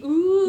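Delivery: high, loud sustained "ooh" tone; imitated TV tone. Co-host mimicking the TV's frequency noise, high held tone. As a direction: hold a high note like a test tone. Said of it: "like, the no frequency".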